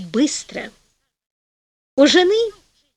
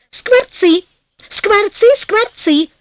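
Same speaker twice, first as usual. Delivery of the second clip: высокие громкие выкрики жены; повторяй громко и высоко, будто топая ногами; капризное упорство; 9 semitones higher, 5 dB louder, much slower than usual. A narrator's reading. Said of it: "затопала ногами жена". (капризно, настойчиво)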